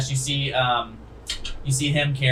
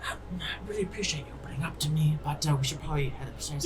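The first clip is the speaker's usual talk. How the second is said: softer, quick hushed words; gentle whisper; 2 semitones higher, 11 dB quieter, faster than usual. Acted. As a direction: soothe her, then nudge her to leave. Morse gently addresses crying woman.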